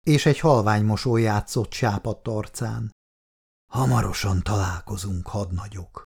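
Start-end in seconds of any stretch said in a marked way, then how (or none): 3.7-6.0 soft, lower, fading last words; dying soldier's final breath. A narrator's reading. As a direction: breathe it out as life slips away.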